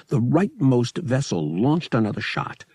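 ordinary delivery

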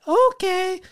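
very high falsetto wife voice; put-on high-pitched character voice; Mrs. Potato Head. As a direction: squeak it like a cartoon housewife. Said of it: "That's how she sounds".

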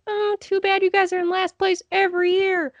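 high, sing-song teasing; mock pity. Yankees fan taunting Orioles fans, mock pity.

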